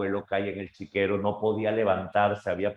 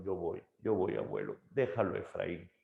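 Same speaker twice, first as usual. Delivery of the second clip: softer, hushed plea; pleading boy; 8 dB quieter than usual. Acted. Boy begs grandfather to spare brother, hushed plea. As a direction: beg him quietly to spare his brother. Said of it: "Enrique dijo".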